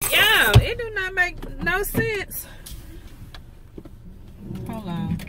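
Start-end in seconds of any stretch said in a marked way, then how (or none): 0.1-2.5 exasperated. Real, not acted. Exasperated at the mail pile.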